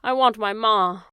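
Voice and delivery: loud, broken wail; the girl wails for her ma to the silent world; wailing cry. Acted. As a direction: let the want burst out of her. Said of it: "cried Meg".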